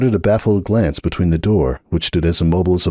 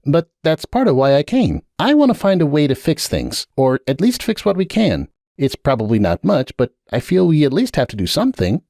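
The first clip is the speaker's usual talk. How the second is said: earnest stallion pleading, pitched higher; gently pushing him to help, higher voice; Sunburst's voice; 8 semitones higher than usual. A narrator's reading. Sunburst urging Hoops to fix things, earnest higher voice.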